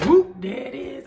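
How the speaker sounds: excited exclamation; excited whoop. Real, not acted. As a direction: shout it out like a party chant. Excited catchphrase.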